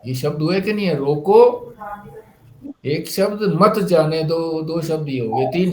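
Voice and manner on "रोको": normal talking voice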